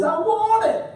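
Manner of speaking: put-on high, demanding toddler voice; whiny two-year-old. A preacher voicing the greedy toddler inside us. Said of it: "That little two-year-old that says".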